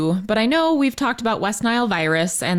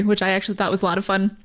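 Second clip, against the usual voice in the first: ordinary talk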